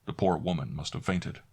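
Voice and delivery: ordinary delivery, nothing marked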